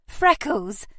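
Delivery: high, loud joyful call; delighted cry. A narrator's reading. Lady greeting her beloved dog, excited cry. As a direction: cry out the name in happy surprise. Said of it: "Nell cried".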